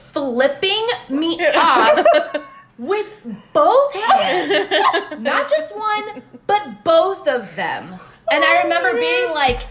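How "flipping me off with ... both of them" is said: slow, emphatic tattle on both hands; outraged tattling. Retold or imitated. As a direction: drag out every word in kid outrage. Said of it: "I remember saying exactly like this".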